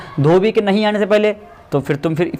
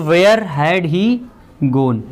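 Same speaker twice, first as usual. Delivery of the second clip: dictating; much slower than usual. Shown as a demonstration. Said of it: "teacher dictating the answer".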